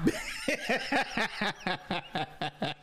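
high breathy burst of laughter. Real, not acted. Laughing at his wife's reaction off camera. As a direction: crack up high and soft.

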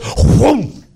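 high mock whoom as the swing hits; cartoon swoosh effect; whoosh of the ride. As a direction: sound the ride sweeping her away. Ride slams into her.

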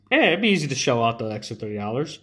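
usual speaking voice

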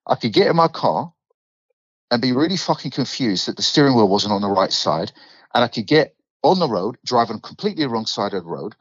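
thick London accent, lively rant; broad Londoner demo. Shown as a demonstration. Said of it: "I want that accent".

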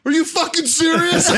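very high squeal of disbelief; hammed-up, laughing screech of outrage; mock incredulous shriek. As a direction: shriek it like the victim of a prank. Prank victim's incredulous outburst.

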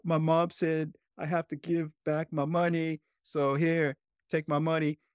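a small boy handing the money back, lightly voiced; crying little boy. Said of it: "I'm like".

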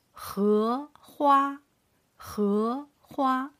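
荷花 with 荷 rising and 花 high and level; second tone, first tone. Shown as a demonstration. Teacher modelling tones for learners, 荷 rising, 花 high level. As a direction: lift 荷 upward, hold 花 steady up high. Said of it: "Starting from second tone".

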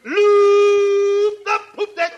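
hammed-up captain commanding the crew; pirate-captain sung orders; mock captain. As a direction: bellow sea orders, ending in a long shout.

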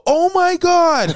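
high, slow mock lady; high shrill lady voice. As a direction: squeal it like a shocked woman. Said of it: "I just hear this lady go".

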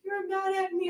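put-on high little-child accusation; imitated upset daughter. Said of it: "She's like".

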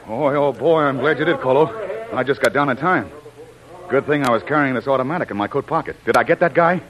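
loud, high, breathless excitement after a close call; rattled but thrilled, pumping the words out loud; keyed-up relief. Acted. Jim thanking Kolo after the shootout, loud and keyed up. Jim just survived gunfire.

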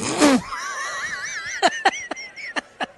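high, shrieking burst of laughter; helpless laughter. Real, not acted. Englishwoman failing at accents, helpless shrieking laugh. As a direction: crack up in long high peals.

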